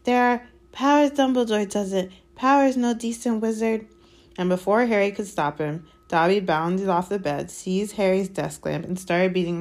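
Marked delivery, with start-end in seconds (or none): 0.0-3.9 high, urgent elf voice; urgently whispering elf. A narrator's reading. Elf hinting at dark powers, urgent. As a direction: press the warning in a high elf voice. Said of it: "dropped to an urgent whisper".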